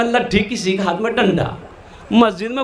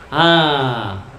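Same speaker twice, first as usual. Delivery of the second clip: normal talking voice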